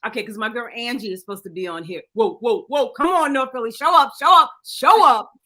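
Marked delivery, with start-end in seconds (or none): 2.1-5.3 much higher and louder chant; rally the crowd to show up; hyped shout. Real, not acted. Loud hype chant calling on North Philly. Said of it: "I have to shout out North Philly".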